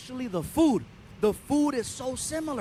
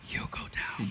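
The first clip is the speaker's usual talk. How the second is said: whispered; 7 dB quieter than usual. Acted. Punchline whispered.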